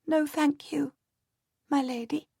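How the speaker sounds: put-on a small boy politely holding back tears; little boy. A narrator's reading. Henry refusing his mother's offer, high small voice.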